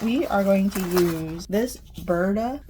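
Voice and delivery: slow Southern drawl on the words; southern accent. Real, not acted. Vlogger catches herself sounding Southern, Southern accent. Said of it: "Why did I say that with the most southern accent?".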